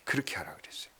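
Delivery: soft, unvoiced closing whisper. Pastor recounting his advice to the wife, whispering. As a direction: let it fall to a whisper.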